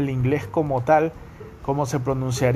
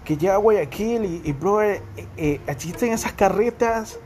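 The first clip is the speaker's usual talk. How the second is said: imitating a snobby brother-talker, pitched higher throughout; posh rich kid; 4 semitones higher than usual. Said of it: "cuando decían".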